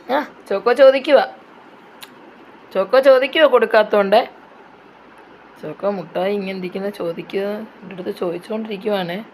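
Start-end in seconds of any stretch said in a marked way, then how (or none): 5.6-9.2 soft, low-key commentary; hushed aside. Real, not acted. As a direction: quietly report it as an aside, voice dropped. Quiet commentary on the begging pet. (പതിഞ്ഞ സ്വരത്തിൽ പറയുന്നു)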